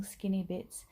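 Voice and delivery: ordinary delivery, nothing marked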